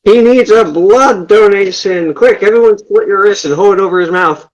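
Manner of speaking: rally everyone as in an emergency; urgent alarm. Acted. Player orders a makeshift blood donation, urgent alarm.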